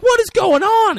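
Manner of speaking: high, loud mock alarm; hammed-up shouted question; acted alarmed shout. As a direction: cry out as the startled onlookers. Friends react to the fight.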